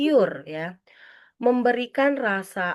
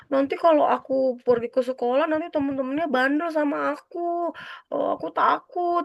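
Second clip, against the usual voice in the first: put-on scared child; 8 semitones higher than usual. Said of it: "fantasi anak".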